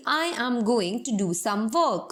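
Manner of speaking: every word slow and separate; Indian-style careful. Shown as a demonstration. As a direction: say each word clearly apart, slowly. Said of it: "अगर मैं इसको Indian में बोलती". (हर शब्द अलग-अलग धीरे बोलें)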